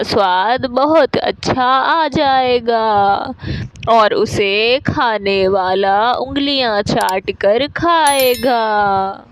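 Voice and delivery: put-on slow, drawn-out old woman's voice; slow granny bird, stretching the endings; old bird, mysterious. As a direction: present the magic gift slowly, like an aged granny. Plays old bird presenting magic spice.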